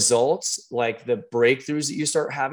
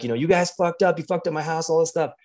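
mock upset yelling; 5 semitones higher, faster than usual. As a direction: mildly mimic someone ranting in complaint. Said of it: "yelling and screaming and up".

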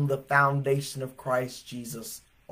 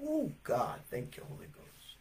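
softly breathed thanks; a hushed aside of worship; quiet praise; 5 semitones lower, 17 dB quieter than usual. Real, not acted. Preacher thanking the Holy Ghost, hushed. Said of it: "preacher's own praise aside".

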